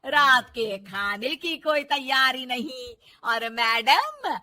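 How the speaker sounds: loud, slow, drawn-out scolding; angry mother-in-law. Acted. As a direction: stretch each complaint, then order her off loudly. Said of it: "बहू की इस बात पर गुस्सा आ जाता है".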